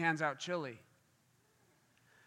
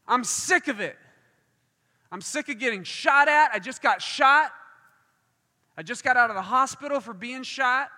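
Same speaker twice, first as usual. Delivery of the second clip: fed-up, raised-voice retelling of an angry man; 3 semitones higher, 5 dB louder than usual. Retold or imitated. Preacher acts out a gunshot victim sick of gang violence, angry. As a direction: act out his agitated venting. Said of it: "he is mad. He is angry".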